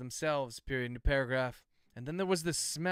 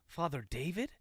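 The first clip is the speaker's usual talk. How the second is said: "Father David" softer, rising steeply at the end; questioning; 5 dB quieter than usual. A narrator's reading. Floyd recognizes the priest, "Father David" as a rising question. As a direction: ask "Father David" with a strong rise on David. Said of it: "Question mark".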